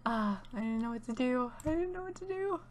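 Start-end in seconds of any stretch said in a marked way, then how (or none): 1.6-2.6 high panicked squeak. Real, not acted. Repeats line, escalating panic.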